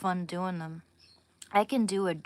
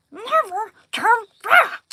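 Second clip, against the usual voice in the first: high loud screeching creature; Smeagol again; 14 dB louder, slower than usual. Retold or imitated. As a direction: screech harder, fix the take. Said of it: "that was bad".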